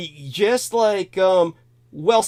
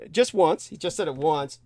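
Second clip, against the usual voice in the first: normal talking voice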